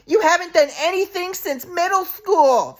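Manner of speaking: slow high put-on mom voice; mocking mom impression. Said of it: "my mom was like".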